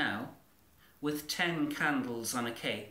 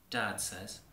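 usual speaking voice

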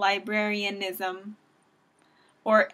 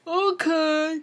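higher-pitched mock gullible voice; naive townspeople; 5 semitones higher, slower than usual. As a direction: imitate clueless people buying the excuse. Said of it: "they were probably like".

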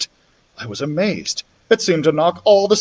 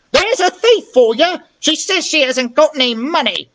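much higher voiced woman, spiteful and urging; heather woman calling boys; 12 semitones higher than usual. The woman calls the street boys to rob the children, spiteful high voice. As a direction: stir the boys up against the children, high and sly.

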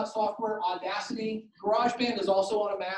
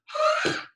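mock wailing server. Server straining, mock wail.

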